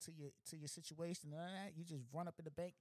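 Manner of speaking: normal talking voice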